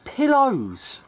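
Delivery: high, loud outburst; loud high-pitched interjection; exclaiming. Real, not acted. Blaming pillows for lost focus.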